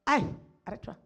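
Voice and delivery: ordinary talk, nothing marked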